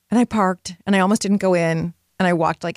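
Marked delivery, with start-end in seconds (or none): none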